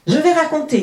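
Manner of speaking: put-on high, loud child announcing; eager, high-voiced eldest sister; eldest child cutting in. A narrator's reading. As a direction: jump in loudly, pitched high. Eldest child seizes the story.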